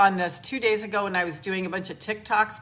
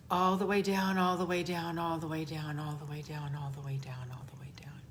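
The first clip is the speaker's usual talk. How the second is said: each repeat lower and softer until whispered; fading to a whisper; 4 semitones lower, 5 dB quieter than usual. Acted. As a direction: guide them down, sinking into a whisper. Guides grounding breath exercise.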